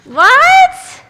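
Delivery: startled narrator seeing a chicken instead of a dinosaur; surprised what. Acted. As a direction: blurt it out in shock.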